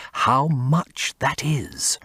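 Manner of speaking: whispered slowly, almost to himself; a slow awed whisper. Acted. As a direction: let it fall to a slow whisper. Basil marvels at the harmony.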